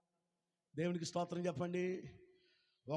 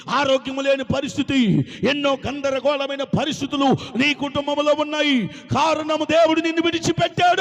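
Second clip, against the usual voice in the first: building from firm to a loud, fast shout; preaching at full force; 6 semitones higher, 14 dB louder than usual. Acted. Preacher warning his flock that God has left, building to a shout. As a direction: hammer each warning harder until you shout it.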